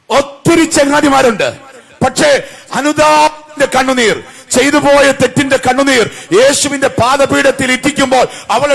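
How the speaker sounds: loud, high, ringing sermon cry; preaching at full force. Real, not acted. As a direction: drive each question out to the whole congregation.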